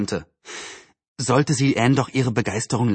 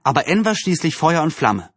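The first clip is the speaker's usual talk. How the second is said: ordinary delivery, nothing marked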